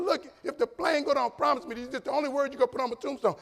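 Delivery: high-pitched insistence; high, animated argument with a spouse; urgent retold plea. Acted plea to his wife.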